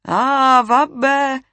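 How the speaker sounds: high, loud shout back; shouted reply. A narrator's reading. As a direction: answer loudly from another room. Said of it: "gridò quella". (risposta gridata, acuta e forte)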